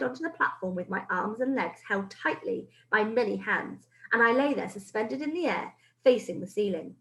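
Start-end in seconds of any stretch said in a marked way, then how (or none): none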